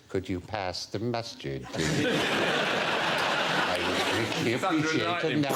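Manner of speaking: slow, mock-sinister film star voice; drawn-out horror-actor impression; Vincent Price. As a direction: savour each word like a horror actor. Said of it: "You just wanted him to say things like".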